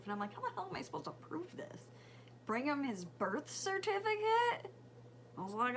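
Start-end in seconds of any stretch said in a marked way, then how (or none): 0.3-4.7 exasperated. Retold or imitated. Exasperated mom, fast.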